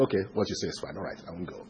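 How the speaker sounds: very soft, low resignation; sentimental giving in. Acted. Believer yielding to tearful loved ones, quiet giving in. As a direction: let the voice sink away as you yield. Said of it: "you become sentimental".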